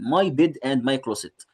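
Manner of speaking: very slow, word by word English; slow reading. Shown as a demonstration. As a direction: read each word slowly for the class. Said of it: "slow reading for class".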